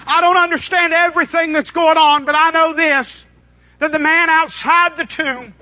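onlookers' words in a high preaching voice; forceful preaching. Retold or imitated. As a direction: proclaim the quote, pitched high.

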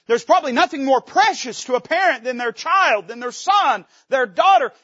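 loud, ringing call; preaching shout. Acted. Preacher stirs parents in the pews, near shouting. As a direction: cry it out to the back pews.

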